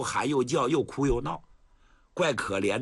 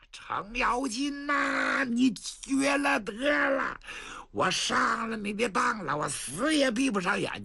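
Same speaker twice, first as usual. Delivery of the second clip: furious cry; 8 semitones higher, slower than usual. Acted. The tricked prince rails at Cheng Yaojin, slow high furious shout. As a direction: curse him with everything you have.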